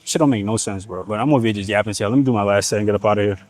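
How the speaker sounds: ordinary talk, nothing marked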